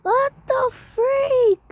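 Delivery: high, slow, tearful wail; mock wail. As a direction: cry it out in hammed-up despair. Playing disaster victim, wailing.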